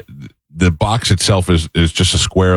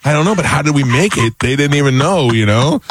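pitched-up retort; 7 semitones higher than usual. Retold or imitated. Own retort, retold.

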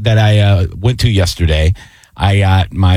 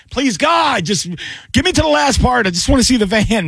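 pissed-off pleading; 12 semitones higher than usual. Retold or imitated. Viewer begging a video to reach the last part, exasperated pleading. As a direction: mock-desperate plea in a much higher voice. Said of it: "I was so pissed".

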